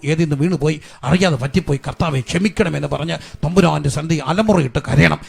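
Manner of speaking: loud, driving revival sermon; forceful preaching. Real, not acted. Preacher urging families to take back curses, forceful preaching. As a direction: preach at full force, pressing for repentance.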